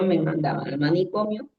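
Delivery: ordinary talk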